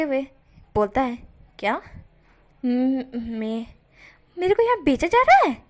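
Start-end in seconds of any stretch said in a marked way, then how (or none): 1.6-5.6 stunned disbelief at being sold; shocked inner thought. Acted. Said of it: "इस तरह से वो बोलता है मन में". (हैरान होकर मन में सवाल)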